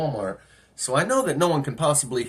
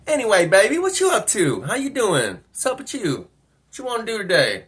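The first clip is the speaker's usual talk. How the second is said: higher-pitched, chatty come-on; imitated flirting woman; 7 semitones higher than usual. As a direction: play the woman chatting up a stranger. Acting the flirting driver.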